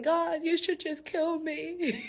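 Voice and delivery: high, slow whine to God; hammed-up whimpering plea; mock fearful despair. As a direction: imitate a frightened man giving up. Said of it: "what the spirit of fear does".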